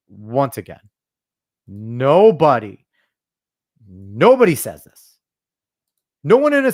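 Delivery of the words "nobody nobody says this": slow, louder, hammering nobody; emphatic nobody. Real, not acted. A husband ranting at relationship advice, slow and emphatic. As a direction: lean hard on each nobody.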